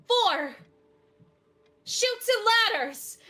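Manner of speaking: loud, high movie-scene cry; calling out. Acted. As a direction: shout it out high like a B-movie actress. Actress shouting out in film scene.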